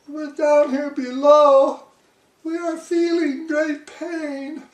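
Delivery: very high, strained pleading turtle voice; groaning plea. A narrator's reading. Crushed turtle at the bottom begging the king, high and pained. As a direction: plead up at the king through the pain. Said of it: "groaned Mac".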